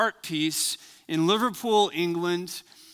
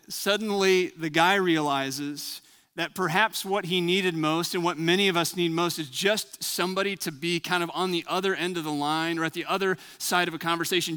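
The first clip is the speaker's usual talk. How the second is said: ordinary talk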